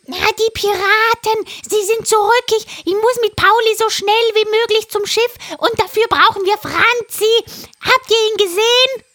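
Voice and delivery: high, loud parrot voice; a frantic parrot urging; excited parrot. Acted. Parrot voice held. (hohe, aufgeregte Papageienstimme)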